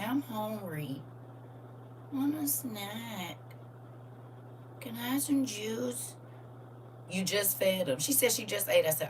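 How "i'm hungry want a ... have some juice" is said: put-on soft, slow, high child voice; pleading little child. Grandchild begging for food on the phone, pleading child voice. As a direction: imitate a small child begging, drawn out and small. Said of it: "little kids will make you feel".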